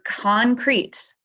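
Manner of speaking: "concrete" slowed right down for weight; emphasis on concrete. Real, not acted. Presenter telling entrants what papers must explore, emphasis on "concrete". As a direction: lean slowly and deliberately on the word "concrete". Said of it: "emphasis on concrete".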